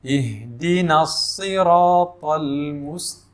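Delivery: slow, drawn-out tajwid chant; slow melodic Quran recitation. Shown as a demonstration. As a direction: recite it slowly like tilawah. Recites verse.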